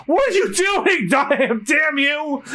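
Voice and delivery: high, shouted curse; high hammed-up angry shout; angry yell. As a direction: yell after him in frustration. Zoro chasing, yelling.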